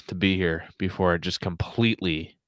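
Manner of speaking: ordinary delivery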